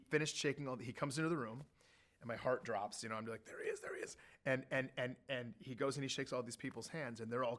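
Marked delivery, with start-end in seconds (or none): 3.4-4.2 awed whisper. Retold or imitated. Heart drops as president enters.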